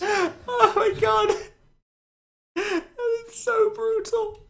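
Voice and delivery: high, breathless laughter through the words; laughing hard. Real, not acted. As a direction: crack up until it nearly turns to tears.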